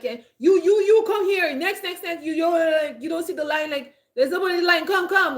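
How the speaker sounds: high, loud, impatient barrage; rude cashier yelling. Shown as a demonstration. Showing how not to call customers, mock rude yelling. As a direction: imitate bossy shouting, word after word. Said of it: "Don't, don't be like".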